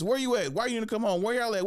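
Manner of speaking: high-pitched run of questions; imitated nagging mom. Mom's voicemails to her missing son, high nagging imitation. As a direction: mimic a mom firing off worried demands. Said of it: "it's my mom".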